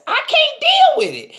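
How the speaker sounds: a sudden high squeal of exasperation; exasperated squeal. Real, not acted. To her viewers about noisy kids, high exasperated squeal. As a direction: shriek it like the noise is too much.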